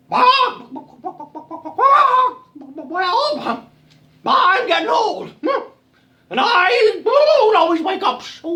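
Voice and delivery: high, loud, squawking rooster voice; bedraggled old rooster. Acted. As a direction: crow the worried complaint. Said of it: "bedraggled looking rooster".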